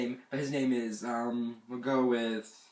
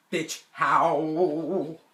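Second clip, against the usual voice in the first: louder, drawn-out disbelief; loud incredulous retort; 3 semitones higher, 7 dB louder than usual. Retold or imitated. Camper rejecting the squirrel excuse again, loud drawn-out incredulity. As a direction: stretch out HOW loudly in disbelief.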